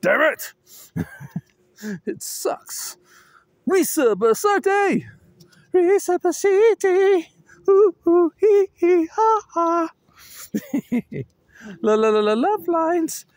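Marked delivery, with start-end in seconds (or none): 3.6-7.3 excited shout. Real, not acted. 7.7-9.9 squealing ooh hee ha; silly high-pitched giggle noises; high silly cackle. Pretend.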